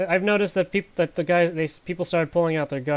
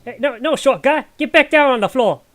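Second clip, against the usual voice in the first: much higher and louder shout; urgent shout; 10 semitones higher, 9 dB louder than usual. Acted. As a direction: yell at him to drop to the floor. Character yells at dwarf to get down.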